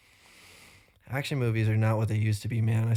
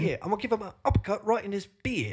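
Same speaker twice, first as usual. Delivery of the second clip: put-on higher, fast kid threat; mock tough kid; 9 semitones higher, faster than usual. Joking the kid threatens Santa, high and fast. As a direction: brag like a tiny action hero.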